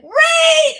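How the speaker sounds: high, loud mock shout of the word; imitating a shouted order. Mimicking her daughter yelling at her to read, loud high shout. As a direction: bark it like a strict study partner. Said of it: "She's like".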